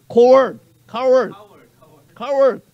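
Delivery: loud repeated Coward from the pulpit; emphatic repetition. Acted. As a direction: hit Coward three times hard. Preacher repeats word, rising emphasis.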